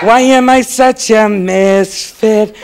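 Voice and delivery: slow, droopy mopey elf voice; whiny Hermie. Retold or imitated. Mocking Hermie whining about being a misfit, slow and mopey. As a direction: drag it out like a wimpy misfit. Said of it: "Remember this character?".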